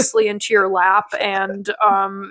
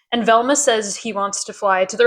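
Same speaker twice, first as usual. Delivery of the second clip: ordinary talk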